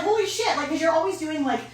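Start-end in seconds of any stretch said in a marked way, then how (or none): 0.0-0.6 mock alarm. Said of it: "I was like".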